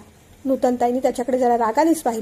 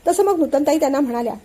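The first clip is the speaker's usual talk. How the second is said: usual speaking voice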